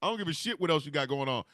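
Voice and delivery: ordinary talk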